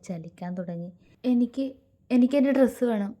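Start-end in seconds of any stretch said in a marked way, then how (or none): 1.2-3.1 higher, louder, quick demand; urgent, raised-voice insistence; sudden outburst. A narrator's reading. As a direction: burst out with it impulsively. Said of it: "പെട്ടെന്നുള്ള ഒരു ആവേശത്തിൽ".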